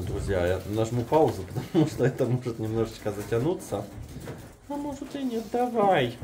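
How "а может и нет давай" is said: very high squeaky voice; squeaky pig voice. Pretend. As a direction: speak for the pig. Voicing the pig's reply.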